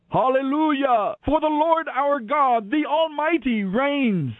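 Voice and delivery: huge crowd's shout. A narrator's reading. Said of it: "what sounded like the shout of a huge crowd".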